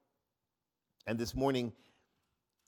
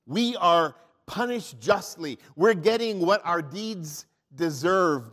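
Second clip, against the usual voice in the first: rebuke; 4 semitones higher, 6 dB louder, slower than usual. Acted. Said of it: "rebuked him".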